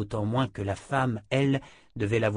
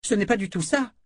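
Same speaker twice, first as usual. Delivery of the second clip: higher, protesting exclamation; crying out; 11 semitones higher, 4 dB louder than usual. A narrator's reading. Jensen protests the sorceress's assumption, crying out. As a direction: protest in a raised pitch. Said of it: "s'écria Jensen".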